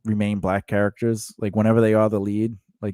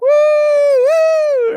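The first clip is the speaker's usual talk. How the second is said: much higher, louder mock crowd whoop; crowd cheer; 9 dB louder than usual. Retelling the Braveheart rock throw, mock cheering crowd.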